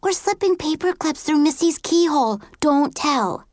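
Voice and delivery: put-on high, worried girl confessing a secret. A narrator's reading. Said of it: "Erica said worriedly".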